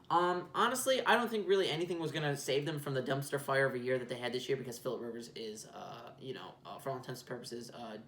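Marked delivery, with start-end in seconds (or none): none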